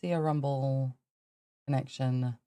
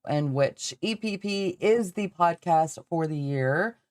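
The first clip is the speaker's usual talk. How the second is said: usual speaking voice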